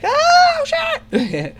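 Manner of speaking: shrieking high in sheer panic; panicked scream. Acted. [name]'s character screaming in panic as they crash. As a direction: scream it out as the ship plummets. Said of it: "screams at the top of his lungs in panic".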